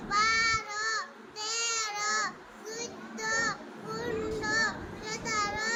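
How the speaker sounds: numbers said slowly through sobs; counting through tears. Real, not acted. Scene: the crying child counts for the uncle, still sobbing. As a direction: sniffle out each number while still crying.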